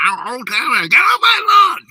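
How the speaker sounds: very high, loud mock yell; cranky old-timer shout. Impression of his friend yelling about his lawn, cranky shout. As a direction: holler like an angry neighbor, voice pushed way up. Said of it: "that's this guy".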